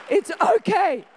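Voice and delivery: high, loud, sung-out comfort; reassuring. Real, not acted. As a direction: reassure the whole room. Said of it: "So it's okay".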